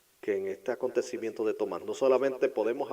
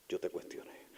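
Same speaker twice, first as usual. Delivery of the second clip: whispered confession; 5 semitones lower, 11 dB quieter than usual. Acted. Repeated softer, whispered.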